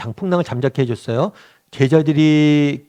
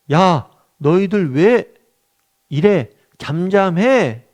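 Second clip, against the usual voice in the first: slow, raised mock scolding; a hint of an annoyed rebuke, higher and slow; rebuking the wind; 4 semitones higher, 4 dB louder, much slower than usual. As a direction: scold the storm like a stern master, drawn out. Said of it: "꾸짖었다라고 이야기를 했어요".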